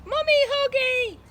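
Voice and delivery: put-on very high, loud, slow toddler wail; screaming baby. A narrator's reading. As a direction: squeal like a baby wanting mummy. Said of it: "screamed the baby".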